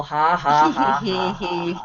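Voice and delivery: low deliberate ha-ha laugh; forced laughter to warm up the giggle. Shown as a demonstration. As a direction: start with low, measured ha-has. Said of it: "warm up that giggle".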